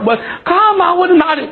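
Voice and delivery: high and fast; stubborn young man insisting; stubborn protest. Acted. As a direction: argue the point hotly. Stubborn refusal.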